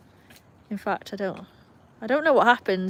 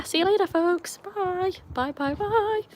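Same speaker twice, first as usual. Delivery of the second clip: sing-song goodbye; 10 semitones higher, slower than usual. Real, not acted. Sing-song goodbye ending the live.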